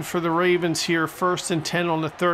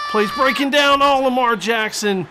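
higher, louder alarmed call; excited play-by-play; 6 semitones higher, 6 dB louder than usual. Real, not acted. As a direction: shout as the play falls apart. Live play collapsing.

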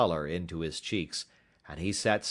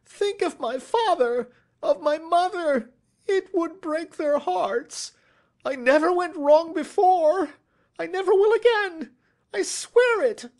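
shrieked pleading; 8 dB louder than usual. A narrator's reading. Culprit begs detective not to go to court, shrieking. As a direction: plead desperately, wailing each phrase. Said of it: "he shrieked".

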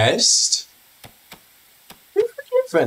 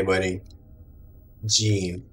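usual speaking voice

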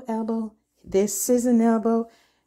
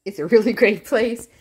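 laughing; 5 dB louder, faster than usual. Real, not acted. Laughs mid-song.

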